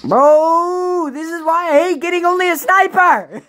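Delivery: high, loud exasperated yell; frustrated yell. Real, not acted. Gamer yelling at the game after losing his sniper, high exasperated shout.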